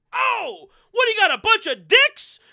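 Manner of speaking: put-on shouted New Jersey accent, high and loud. Husband walking into the kitchen, shouting in a New Jersey accent. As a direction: bark it like a Jersey guy. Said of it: "that's my best New Jersey accent".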